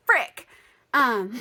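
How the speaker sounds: loud frick then a laugh; laughing exclamation. Real, not acted. Reacting to endless puking, laughing. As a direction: blurt it and laugh.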